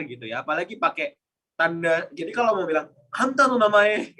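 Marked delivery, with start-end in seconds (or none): 3.1-4.2 "Anta no namae" slow, raised and rough; rough intonation. Shown as a demonstration. Teacher demonstrating the rough intonation of the rude question, slow. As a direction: drag "Anta no namae" out bluntly. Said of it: "ada intonasinya juga".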